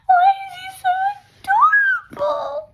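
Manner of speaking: overwhelmed adoring squeal. Real, not acted. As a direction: squeal over how cute he is. Fangirling over character.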